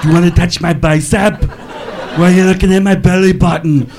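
heavy Austrian accent with mock-cool questions; Schwarzenegger impression. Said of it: "He can ask any question that sounds cool".

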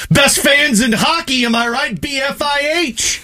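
high, punchy hype-up call; rallying cry. Pretend. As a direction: shout it out like a fan rallying the crowd.

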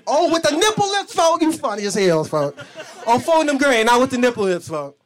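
high, loud laughing gossip; gossiping friend. Retold or imitated.

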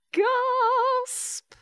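loud, high, stretched-out "gasp"; dramatic gasp. A narrator's reading. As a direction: act out a sudden shock. Sudden gasp acted out as word.

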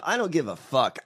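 usual speaking voice

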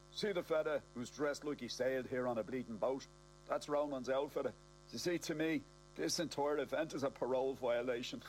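ordinary delivery

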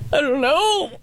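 high, teary "I don't know"; helpless high-pitched answer while weeping; crying I don't know. Retold or imitated. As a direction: squeak it out through tears. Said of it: "and if somebody goes".

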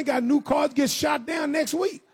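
ordinary talk

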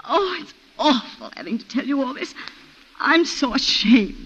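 distressed, halting and close to crying; ashamed, tearful. Acted. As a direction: voice catching with tears, slowing down. Wife breaks down mid-testimony.

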